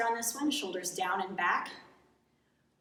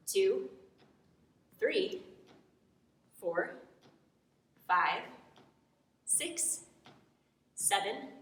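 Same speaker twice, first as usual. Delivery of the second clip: counting while holding a squat; talking from a held low squat; in a deep squat; 3 dB quieter, slower than usual. Real, not acted. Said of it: "So I'm maintaining the squat".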